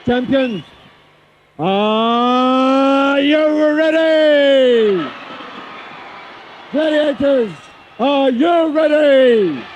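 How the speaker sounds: slow, stretched "are you ready"; drawn-out referee call. Acted. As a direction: stretch each word out to rile the crowd. Referee starts the event.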